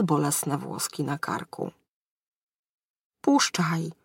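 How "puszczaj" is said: breathy whispered groan, no voice; a pained whisper from a hungover wizard. Acted. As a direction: moan it out under your breath. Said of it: "jęknął".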